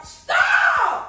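high, sudden yell; exasperated shout. Real, not acted. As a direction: shout to make them stop. Angry at being touched.

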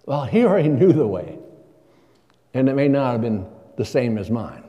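ordinary talk, nothing marked

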